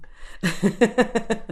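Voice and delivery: high burst of laughter; amused, high-pitched laughing. Real, not acted. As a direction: laugh out at the thought. Laughing at imagined singing in street.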